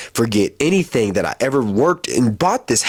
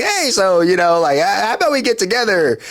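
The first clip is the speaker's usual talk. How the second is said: high-pitched hopeful pitch to a crush; eager flirting; 8 semitones higher than usual.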